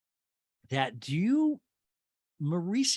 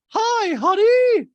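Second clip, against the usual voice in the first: very high, loud, drawn-out squeal; Dorit; 11 dB louder, much slower than usual. Retold or imitated. Podcaster mimicking Dorit saying hi, hammed-up squeal. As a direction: stretch the greeting into a mock shriek.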